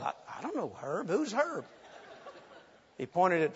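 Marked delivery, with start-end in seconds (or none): 0.0-1.6 much softer, puzzled aside; hushed confusion. Retold or imitated. Helper wondering to himself who Herb is, hushed. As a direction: mutter it to yourself, baffled.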